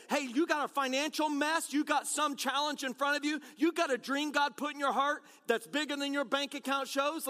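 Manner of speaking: ordinary delivery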